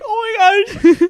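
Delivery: high, loud, drawn-out tearful wail; laughing imitation of crying; mock sobbing. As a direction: wail it slowly, half laughing. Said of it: "And I started sobbing".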